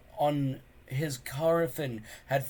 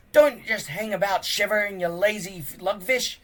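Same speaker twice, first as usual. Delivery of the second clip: put-on loud, high roar at the boys; crazy bellow; 7 semitones higher, 6 dB louder than usual. Gobber yelling at shivering boys, crazy bellow. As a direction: bellow like a mad drill sergeant. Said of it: "Gobber bellowed crazily".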